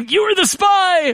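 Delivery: high slow gasping accusation; mock shocked accusation. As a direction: pretend to unmask a spy.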